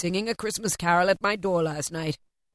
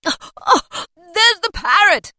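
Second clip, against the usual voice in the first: high, loud gasping delight; breathless "oh, oh", then a delighted shout; excited cry; 7 semitones higher, 7 dB louder than usual. A narrator's reading. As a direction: cry out at a thrilling sight. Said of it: "cried Scrooge".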